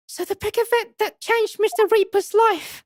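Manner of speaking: very high, excited character voice; high-voiced game character. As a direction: pitch it right up in character.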